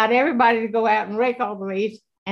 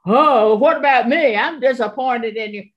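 a louder, nagging, disappointed voice; accusing enemy voice; 2 semitones higher, 6 dB louder than usual. Retold or imitated. Warning listeners about the enemy's voice, accusing. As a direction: voice the inner accuser, guilt-tripping. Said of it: "if you hear that voice that says".

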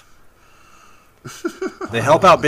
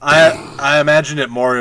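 normal talking voice

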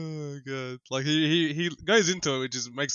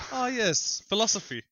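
mock posh enthusiasm; 9 semitones higher, slower than usual. Mimicking a bookish persona.

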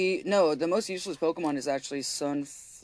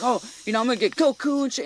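confident buff guy; 8 semitones higher, faster than usual. Retold or imitated. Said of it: "He's like".

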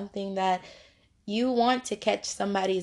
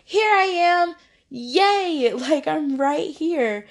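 higher, louder jump-out cheer; excited reveal; 6 semitones higher, 4 dB louder, slower than usual. Acted. Wisdom jumping out to be found, loud excited cheer. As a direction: announce yourself like a kid popping out. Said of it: "That's what wisdom is like".

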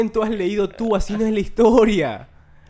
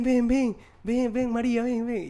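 higher-pitched imitation of urgent beckoning; repeated mock-urgent calls, pitched up; acted calling over; 6 semitones higher, slower than usual. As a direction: mimic friends summoning the girlfriend. Friends summon the girlfriend in story.

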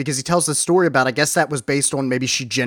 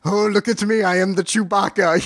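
mock Chewbacca; 5 semitones higher than usual. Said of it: "Do your Chewbacca impression".

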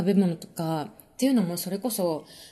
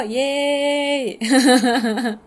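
cheer; 4 semitones higher, 6 dB louder, much slower than usual. Real, not acted. Cheering a fan's comment.

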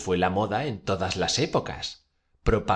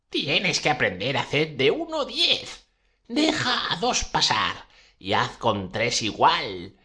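emphatic declamation; 5 semitones higher than usual. Acted. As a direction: declaim the spell like a stage witch. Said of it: "empieza a declamar en el libro con gran énfasis". (declamación enfática y teatral de bruja)

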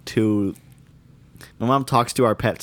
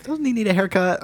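higher passive aggressive mom; much higher pointed remark to the dog; 11 semitones higher than usual. Retold or imitated. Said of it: "she'd be like".

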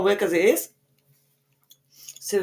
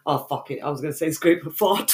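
ordinary talk

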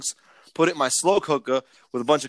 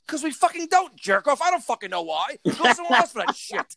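high, exploding mock yell at the customer; retold angry outburst; 10 semitones higher than usual. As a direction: snap back, pretending to lose it. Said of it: "I'm like".

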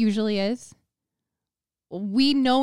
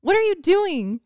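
self-scolding; 7 semitones higher than usual. Retold or imitated. Scolding herself for waiting a year, mock exasperated. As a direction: scold yourself, half-joking. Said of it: "I'm like".

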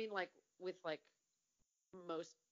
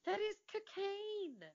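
incredulous; 13 semitones higher, 3 dB louder than usual. Retold or imitated. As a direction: stretch it out in disbelief. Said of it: "I'm like".

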